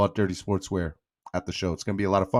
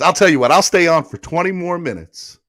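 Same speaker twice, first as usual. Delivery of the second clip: high, loud hype promise; excited announcement; 7 semitones higher, 9 dB louder than usual. Real, not acted. Streamer promising viewers more stream time, loud excited. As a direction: sell it like a big announcement.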